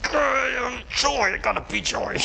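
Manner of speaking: high, strained fake cheer; mock someone forcing happiness; hammed-up forced joy. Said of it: "You don't s".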